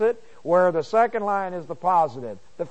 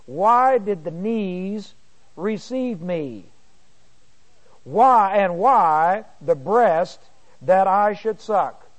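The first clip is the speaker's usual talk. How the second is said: slow, deliberate reading of verse, louder on the second Why; scripture reading; 4 dB louder, slower than usual. Acted. Bible teacher reading Job to students, slow and solemn. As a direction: read the verse out slowly to the class.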